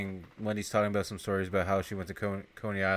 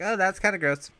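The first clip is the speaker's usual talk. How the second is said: put-on grossed-out girl voice; 9 semitones higher, 4 dB louder than usual. Wendy voiced, over the body.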